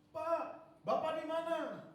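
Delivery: high, loud panicked plea over the phone; pleading in a panic, voice up high; frantic caller. Retold or imitated. Hospital caller begging the pastor to come, panicked.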